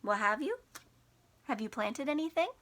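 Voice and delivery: ordinary delivery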